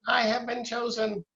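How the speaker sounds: high squeaky alien voice; squeaky little alien. Retold or imitated. Quoting the alien line.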